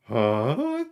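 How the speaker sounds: a light gruff sitcom-dad grunt of a question; a hint of a sitcom dad's puzzled grunt; Tim Allen. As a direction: toss off a quick Home Improvement style huh. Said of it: "sounded a little too much like, uh, um, Tim Allen".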